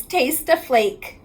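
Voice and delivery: ordinary delivery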